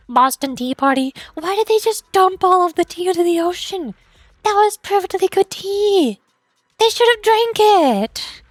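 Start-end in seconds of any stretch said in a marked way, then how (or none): 1.3-8.4 high, drawn-out wail of protest; mock anguished lament. Tea lover mourning the Boston Tea Party, overdramatic wailing. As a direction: whine dramatically over a great loss.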